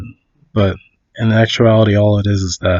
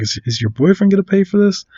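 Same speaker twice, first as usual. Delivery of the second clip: higher-pitched mock indignation; retold yelling; 8 semitones higher, faster than usual. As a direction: imitate an irate driver pressing for money. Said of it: "he's yelling at her".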